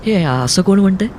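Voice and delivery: high-pitched, pointed query; challenging question. Acted. Kaumudi interrupts Aruni's game to challenge him, pointed question. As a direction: ask it high, calling him out.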